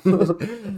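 laughing. Real, not acted. Laughs at Italian name.

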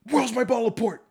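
high, sharp put-on waitress voice demanding the port; a snotty coworker barking at the bartender; mock waitress demand. High mocking voice of a rude waitress at the bar.

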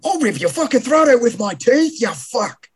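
high, hammed-up furious chef; a mock Gordon Ramsay tirade at a customer; Gordon Ramsay rant. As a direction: threaten like a raging TV chef. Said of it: "That's my Gordon rant".

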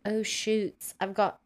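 lightly put-on British accent, casual; a hint of British accent; mock British accent. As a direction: play him as a breezy Englishman. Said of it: "he becomes British".

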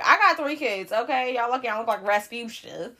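loud, sassy mock comeback; playfully telling off the critics; mock defiant. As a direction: pitch it up and clap back loudly.

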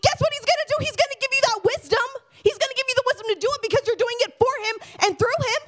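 very high, insistent preacher voice; fervent preaching. Real, not acted. Preacher promises each listener God's strength, fervent and high.

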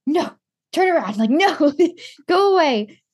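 high, urgent pleading to turn away; frantic shooing. Retold or imitated. To the patient who turned on someone, frantic shooing. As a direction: hurry him off, half panicked.

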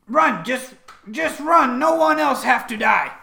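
high, loud desperate warning; acted shout, pitched well up. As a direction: shout at them to flee. Game master voices bird warning enemies.

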